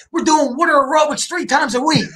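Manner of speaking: high-pitched, excited mom quote; excited boasting. Retold or imitated. Elderly mom reporting to her son on retirement life, excited. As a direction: imitate a mother thrilled with her activities.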